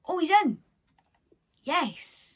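loud, delighted outburst; excited celebration. Real, not acted. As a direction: celebrate a lucky win out loud. Celebrating a hoped-for player pull.

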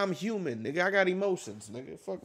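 normal talking voice

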